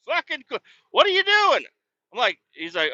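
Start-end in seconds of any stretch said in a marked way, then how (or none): 0.0-1.7 loud high yell across the yard. Retold or imitated. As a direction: yell at the driver blocking the pit. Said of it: "yell at him".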